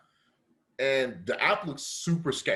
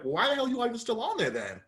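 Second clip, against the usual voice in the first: pitched-up scoffing question; incredulous retort; 10 semitones higher than usual. Retold or imitated. Customer to the delivery driver, high scoffing disbelief. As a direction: fire it back in disbelief.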